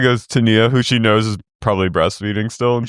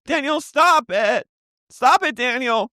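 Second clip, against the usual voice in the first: much higher, slow hammed-up shout; mock shrieking scold; 13 semitones higher, slower than usual. Co-host imitating Nia yelling at her boyfriend, hammed-up shout. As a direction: shriek it like a frantic girlfriend.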